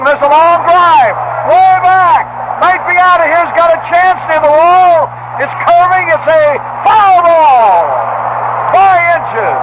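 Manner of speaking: high-pitched, stretched-out home-run call; big excited ballpark holler; excited play-by-play call. Real, not acted. Excited call of a long drive.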